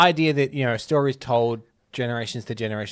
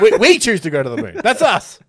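loud, high speechmaker making it up as he goes; hammed-up stump speech, groping for reasons; JFK parody; 6 semitones higher, 9 dB louder than usual. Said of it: "J F K's famous speech".

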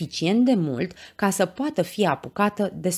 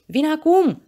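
high, loud call to someone in danger; urgent shout; 11 semitones higher, 6 dB louder than usual. A narrator's reading. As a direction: shout reassurance across a distance. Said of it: "strigă Pancinelo". (strigăt grăbit, pe ton înalt)

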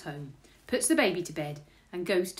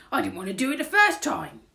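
loud, higher burglar protest; aggrieved burglar character; indignant burglar; 5 semitones higher, 8 dB louder, faster than usual. A narrator's reading. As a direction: complain it as a put-upon burglar. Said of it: "said Burglar Bill as he rubs his nose".

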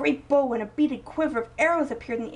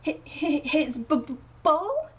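stammering surprise; 5 semitones higher, 3 dB louder, slower than usual. Acted. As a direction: caught off guard, stammering and climbing higher. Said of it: "they stammered in surprise".

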